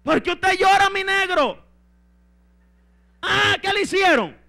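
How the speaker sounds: loud, high-pitched exaggerated questioning; hammed-up wife's demanding question; Jezabel questioning. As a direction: call out to a crying husband. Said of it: "Y le dice a Jezabel".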